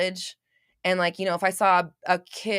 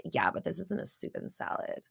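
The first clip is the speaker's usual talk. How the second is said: whispered under the breath, like a grumbling diner; a hushed, voiceless gripe about the wrong order; whispered complaint; 10 dB quieter than usual. Retold or imitated. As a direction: mutter it as an unvoiced aside.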